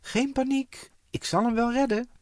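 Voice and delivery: high, loud confident call. A narrator's reading. Trixie calling to friends, loud and high. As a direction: shout down the cliff that you'll save him. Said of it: "riep Trixie".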